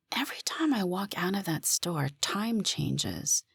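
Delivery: soft, half-whispered realization; said quietly. Acted. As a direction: hush it, almost breathless. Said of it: "I said quietly".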